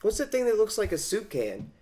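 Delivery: high-pitched, curious cartoon character voice; high and naively inquisitive impression; Donut. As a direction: put on a high, innocent wondering voice. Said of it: "and Donut's just like".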